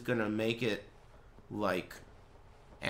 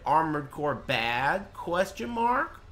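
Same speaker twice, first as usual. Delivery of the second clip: slow, drawn-out quote climbing high on "question mark"; mock quoting; 3 semitones higher, slower than usual. Streamer parroting the viewer's tagged message, mock quoting. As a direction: stretch out the viewer's words into an exaggerated question. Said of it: "you tagged me and said".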